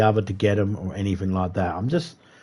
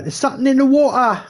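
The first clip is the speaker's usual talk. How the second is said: much higher, mock English accent; English accent impression; 4 dB louder, faster than usual. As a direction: ham up an English movie actor with a British accent. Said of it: "There's an English guy in it, and he's like".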